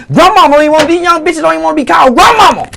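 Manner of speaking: indignant. Real, not acted. Indignant rant about grandmothers.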